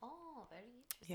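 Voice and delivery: softly spoken mock German shrink; German accent. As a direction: murmur it like a Freudian analyst. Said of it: "having trouble doing German".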